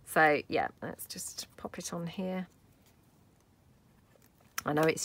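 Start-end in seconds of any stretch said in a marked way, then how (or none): 0.8-2.5 soft whisper, a little slower. Real, not acted. Crafter placing a butterfly on camera, whispered. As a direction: breathe it out while placing it.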